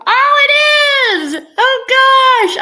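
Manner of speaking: high, loud tearful outburst of joy; overjoyed cry. Real, not acted. Mother spotting her son in chat, crying out with joy. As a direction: cry out in joyful recognition.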